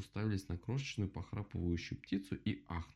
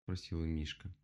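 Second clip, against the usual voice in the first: ordinary delivery, nothing marked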